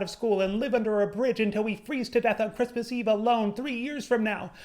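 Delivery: mock freak-out, building; panicking groupmate. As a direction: spiral into panic about failing the project. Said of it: "one of the people in the group was saying".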